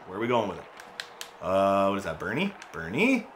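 usual speaking voice

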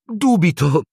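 bright high cheerful cut-in, firm underneath; cheerful but firm. A narrator's reading. Dumbledore cheerfully ending the teachers' argument, firm. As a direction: cheerfully close the topic. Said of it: "con voce allegra ma decisa".

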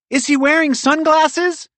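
high, loud anxious question; high-pitched squirrel character shouting; shouting back up. A narrator's reading. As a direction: shout it up to the treetop. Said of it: "she shouted back up".

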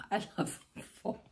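breathy giggle over the words; can't keep a straight face; laughing through it. Real, not acted. Saying her pun to viewers, laughing. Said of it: "tried so hard to say it with a straight face".

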